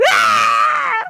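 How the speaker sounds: loud, very high mock screech; imitated child scream. Child rejecting yogurt from mother, loud mock scream. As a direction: screech out a tantrum refusal. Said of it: "she starts screaming".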